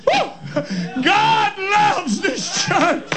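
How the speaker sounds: high, shouted Pentecostal fervor; full-force preaching. Real, not acted. Preacher telling the congregation God loves this church, ecstatic shouting. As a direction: shout it ecstatically, drawing the words out.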